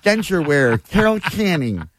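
high mock Carol Channing greeting. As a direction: wish him happy birthday as Carol Channing. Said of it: "got a Carol Channing impression locked and loaded".

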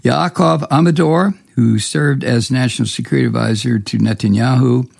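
normal talking voice

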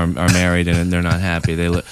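ordinary talk, nothing marked

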